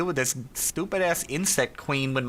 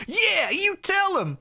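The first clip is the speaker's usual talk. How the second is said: very high, drawn-out cheer; cheering him on; slower than usual. Retold or imitated. Viewer cheering the pharaoh on, high excited drawl. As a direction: whoop it up high in support. Acting out own cheering reaction.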